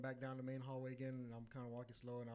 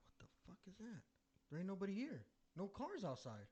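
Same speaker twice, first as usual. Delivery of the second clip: higher-pitched, hushed start to a startled question; mock baffled disbelief, pitched up; baffled alarm; 7 semitones higher than usual. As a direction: retell a startled "who's there?" reaction. Startled reaction to mystery piano.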